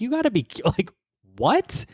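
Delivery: a high, stunned what with a rise; incredulous. Real, not acted. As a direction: jump up in shock. Shocked reaction to the show.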